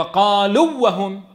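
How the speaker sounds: fuse the oo of "قالوا" into the w of "وهم"; merged waw. Shown as a demonstration. Teacher demonstrating the wrong merging to a student, waws fused. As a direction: say "قالوا وهم" as one word, short u into a doubled w. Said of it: "ادغم لي إياهم الواو في الواو".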